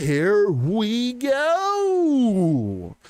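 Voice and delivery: slow drawn-out announcer call; studio announcer. Shown as a demonstration. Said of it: "as I sound like a studio announcer".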